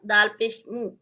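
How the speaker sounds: ordinary talk, nothing marked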